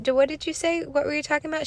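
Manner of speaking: imitating a mom's eager baby-talk; mother quizzing toddler. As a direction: press the little one gently, voice lifted.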